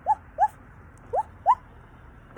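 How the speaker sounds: very high, loud little yaps; a trapped puppy barking for help; barking dog. Acted. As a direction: yap like a small dog buried under rubble. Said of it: "Edward heard".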